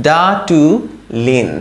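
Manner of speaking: ডাটুলিন stretched out syllable by syllable; slow sounding-out. Shown as a demonstration. Teacher showing the whole word's pronunciation, slow sounding-out. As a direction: draw out DAA-TU, then land on LIN. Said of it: "তাহলে কিরকম হয় এই পুরা".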